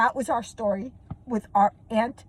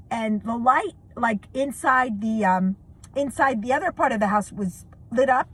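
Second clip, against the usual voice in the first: ordinary delivery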